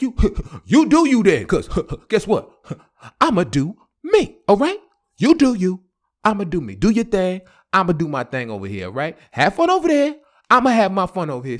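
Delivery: loud, laughing comeback; heated reply. Retold or imitated. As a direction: fire back loudly through laughs. His heated reply in breakup.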